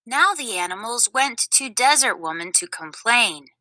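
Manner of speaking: usual speaking voice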